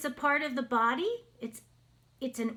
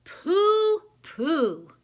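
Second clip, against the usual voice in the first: dismissive pooh; 5 semitones higher, 6 dB louder than usual. Acted. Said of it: "Madeline said".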